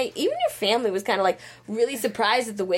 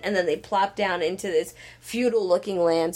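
normal talking voice

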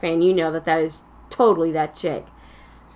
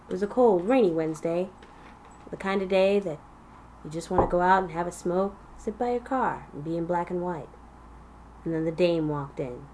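noir detective; 9 dB quieter than usual. Pretend. Parody noir detective narration.

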